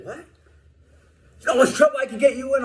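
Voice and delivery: high, fast, indignant threat; imitating a guard threatening to report him; mock indignant guard. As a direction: squeak it out like a petty official.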